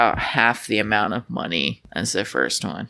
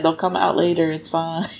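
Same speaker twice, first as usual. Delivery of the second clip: ordinary talk, nothing marked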